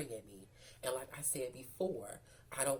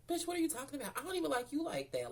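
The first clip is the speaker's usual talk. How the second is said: retold indignant retort; 11 semitones higher, faster than usual. Retold argument with jealous T.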